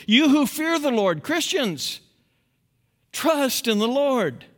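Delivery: high, loud declaiming of the psalm; fiery psalm reading; preached scripture. Acted. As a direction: proclaim it to the congregation.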